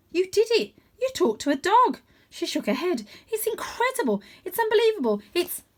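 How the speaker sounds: high, excited cat voice; Holly amazed. A narrator's reading. Holly amazed the cat talked to a dog, high. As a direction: gush with disbelief.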